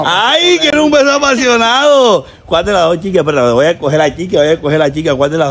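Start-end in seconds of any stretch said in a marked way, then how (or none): 0.0-2.3 high, teasing imitation of longing; mock swooning echo. Host mimicking man's wish for a kiss, mock swooning.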